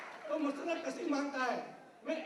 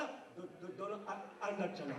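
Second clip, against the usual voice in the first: low, soft, stuttered order; stammering angry inspector; 7 semitones lower, 5 dB quieter, slower than usual. Retold or imitated. Angry inspector ordering both inside, stammering. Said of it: "दरोगा को गुस्सा आया और दरोगा बोला".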